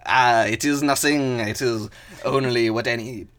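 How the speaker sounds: effortful gallant character voice; hammed-up humble champion; put-on toad voice. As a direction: play a modest toad hero, voice put on. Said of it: "God, the voice is gonna destroy me".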